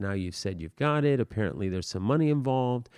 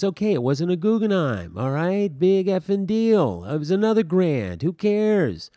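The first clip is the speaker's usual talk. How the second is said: higher and louder, exasperated brother snapping back; defensive outburst; 7 semitones higher, 5 dB louder than usual. Retold or imitated. Teacher retelling the brother's reply to his sister, mock exasperated outburst. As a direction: play the cornered brother throwing it off, irritated.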